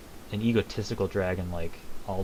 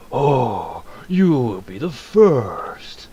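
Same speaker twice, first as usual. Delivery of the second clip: slow, louder, cartoonish villain drawl; silly villain voice; 2 semitones higher, 6 dB louder, much slower than usual. Mocking how Alduin should not sound: hammed-up villain. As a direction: ham it up like a cheesy villain. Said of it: "make Alduin not sound like".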